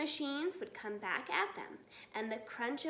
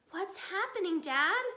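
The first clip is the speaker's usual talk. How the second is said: high, louder frightened cry; cried out; 5 semitones higher, 5 dB louder than usual. A narrator's reading. Small foxes crying out to their father. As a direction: call out to Dad in alarm. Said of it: "cried the small foxes".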